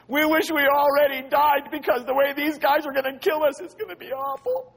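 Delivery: exaggerated fearful complaint in a strained high voice; mock scared wailing. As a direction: wail like a panicked crowd, fading at the end. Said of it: "They were scared".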